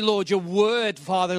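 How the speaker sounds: ordinary delivery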